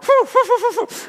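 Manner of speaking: high yelping laugh-cry; panicked squeal. Retold or imitated. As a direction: squeal as if out of air. Panicking underwater.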